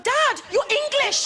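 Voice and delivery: high, drawn-out cry to dad; exasperated protest. Retold or imitated. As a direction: wail it slowly, exasperated with dad. Embarrassed daughter correcting her dad.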